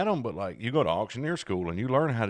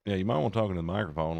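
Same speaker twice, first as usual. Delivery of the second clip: ordinary talk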